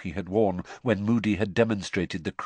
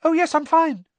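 rushed, much higher, louder; high gabble; 7 dB louder than usual. Acted. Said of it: "Neville gabbled".

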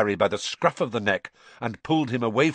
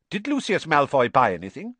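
sharp; 6 semitones higher, 3 dB louder than usual. A narrator's reading. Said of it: "said Mister Weasley sharply".

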